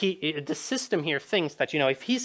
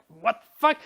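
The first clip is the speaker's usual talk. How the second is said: higher-pitched mock disbelief; baffled player; 6 semitones higher, 4 dB louder than usual. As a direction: act out a player caught off guard. Player baffled by repeated line.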